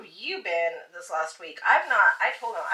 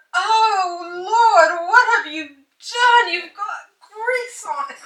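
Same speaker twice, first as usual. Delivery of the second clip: high, loud, drawn-out horrified wail; mock screaming; 10 semitones higher, 13 dB louder, much slower than usual. Wife acts out husband upset over smudged TV, mock screaming. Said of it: "they're gonna start screaming".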